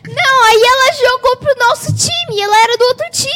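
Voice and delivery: excited. Real, not acted. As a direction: stress each word excitedly. Excited retelling of the match.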